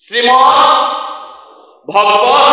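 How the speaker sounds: ভগবত set apart, short first vowel, rising end; careful pronunciation. Shown as a demonstration. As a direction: sound out ভগবত after a clear pause. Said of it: "উচ্চারণ কি হবে".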